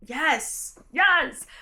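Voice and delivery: high, thrilled double yes; eager yes. Retold or imitated. Hearing about a bisexual icon spy, thrilled and high. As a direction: jump at it like a delighted fan. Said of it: "I was like".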